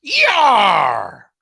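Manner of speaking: loud, hoarse campaign yell; hammed-up rally yell; Howard Dean scream. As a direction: bellow it like the famous Dean scream. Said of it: "That's my Howard Dean impression".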